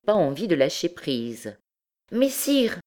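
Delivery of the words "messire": high, quick hail; eager, lively. A narrator's reading. Curious young woman keeping the stranger from leaving, lively. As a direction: call him back eagerly. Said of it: "dit vivement Gisquette".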